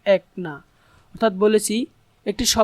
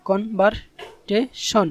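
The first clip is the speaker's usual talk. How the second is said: "কনভারটেশন" very slow, a pause between syllables; much slower than usual. Shown as a demonstration. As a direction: break "কনভারটেশন" into separate syllables for learners. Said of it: "এগুলোকে ভাগ করব".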